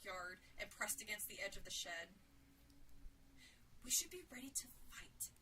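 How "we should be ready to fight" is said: firm, tense whisper; whispered resolve. A narrator's reading. Sarah to Jill beside the shed, tense whisper. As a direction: whisper it as a warning. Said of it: "Sarah whispered to Jill".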